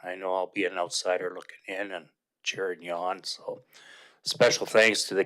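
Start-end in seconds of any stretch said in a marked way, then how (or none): none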